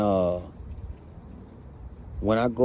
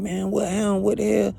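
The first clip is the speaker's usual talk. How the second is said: higher, shocked questions; 7 semitones higher than usual. Retold or imitated. Hearing the mother is dead, higher and shocked. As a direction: demand what happened. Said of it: "I'm like".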